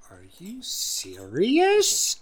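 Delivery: slow voiceless whisper of disbelief; stunned, barely audible; shocked whisper. Acted. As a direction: whisper it, jaw on the floor. Jaw drops in shock.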